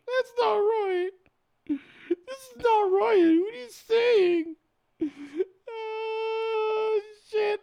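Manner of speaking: high, slow, hammed-up sob; mock crying. Streamer fake-sobbing over the headline, mock cry. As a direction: wail it, overdone and slow.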